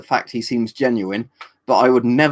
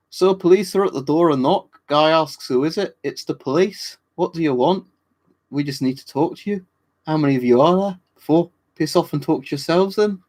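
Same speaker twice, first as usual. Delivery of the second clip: higher-pitched, hammed-up bad Scottish accent read; 6 semitones higher than usual. Reading a chat joke to viewers in a bad Scottish accent. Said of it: "I'll try and do a really bad Scottish accent to read that".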